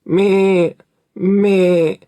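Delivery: loud bleating goat cry. A narrator's reading. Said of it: "and the goat bleated".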